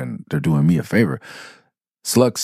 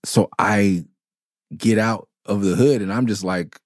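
ordinary talk

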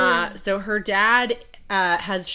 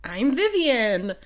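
high, drawn-out greeting with a pasted-on smile; forced cheeriness; 8 semitones higher, slower than usual. Acted. Bride greets her arranged fiancé, falsely cheerful. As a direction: chirp it sweetly, putting on niceness. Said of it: "plastering on a smile".

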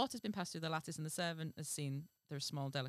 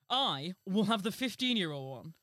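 loud, grand royal pronouncement; king's voice; 9 dB louder than usual. A narrator's reading. King demanding the girl from servant, loud royal command. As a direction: command it like a pompous storybook monarch. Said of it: "His Majesty answered".

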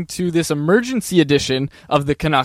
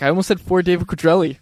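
ordinary talk, nothing marked

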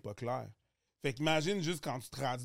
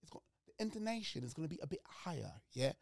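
higher-pitched, softer English accent; standard English accent from England; 5 semitones higher, 7 dB quieter than usual. Shown as a demonstration. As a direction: switch into an England accent, intonation lifted. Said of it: "mon accent normatif du, euh, de, de, de l'Angleterre". (accent anglais d'Angleterre, plus aigu)

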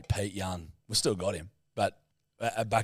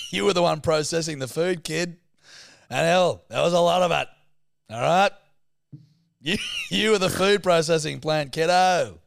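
mock scolding; 4 semitones higher, 5 dB louder than usual.

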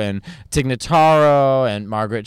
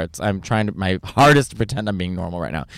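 ordinary delivery

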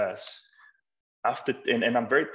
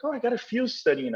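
high, quick, chirpy quote; imitating a pleased grandmother, pitched way up; imitated eager reply; 12 semitones higher, faster than usual. Carrier woman telling of grandkids studying Italian, imitated eagerness.

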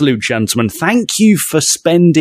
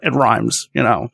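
George Lucas. Acted. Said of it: "That's my George".

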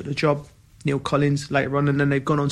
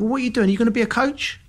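intense coach; 9 semitones higher, faster than usual. Retold or imitated. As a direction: pitch raised high, pushing hard. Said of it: "And she went".

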